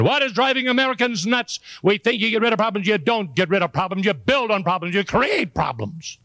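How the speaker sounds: high-pitched comic exasperation; exasperated rant. Acted. Speaker ranting about Americans and problems, high and exasperated.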